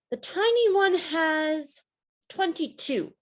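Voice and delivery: put-on high, tiny little-girl voice; small girl voice. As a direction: squeak it out like the smallest kid guessing. Little Anna's small-voice guess.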